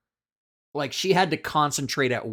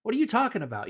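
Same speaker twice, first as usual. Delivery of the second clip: pitched way up, baffled; puzzled relative voice; 9 semitones higher, faster than usual. Retold or imitated. Relative puzzled at Rob's question, in a high baffled voice. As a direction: play the confused old uncle, voice jumping high. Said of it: "they go".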